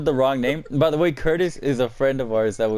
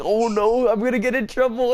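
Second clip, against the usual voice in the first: mock panic; 11 semitones higher than usual. Imitates friend panicking over the hole.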